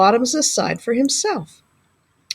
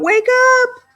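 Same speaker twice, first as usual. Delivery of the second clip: calling out; 8 semitones higher than usual. A narrator's reading. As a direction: shout across to the sleeping bear. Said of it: "Hare called out".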